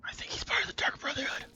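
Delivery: unvoiced conspiratorial whisper. Real, not acted. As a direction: whisper it to the audience. Secret aside about the dealer.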